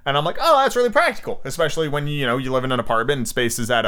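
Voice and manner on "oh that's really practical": much higher, pleasantly surprised; impressed realization. Retold or imitated. Shopper discovers the couch doubles as storage, pleased surprise. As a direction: recall being won over, voice lifting high. Quotes own pleased reaction in shop.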